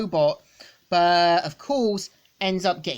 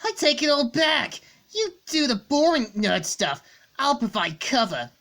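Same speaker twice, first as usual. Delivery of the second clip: eager Scourge; 8 semitones higher than usual. Acted. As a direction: jump in with brash enthusiasm. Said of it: "we see Scourge saying".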